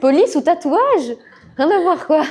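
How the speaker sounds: laughing, disbelieving echo; amused disbelief. Real, not acted. Incredulous echo of student.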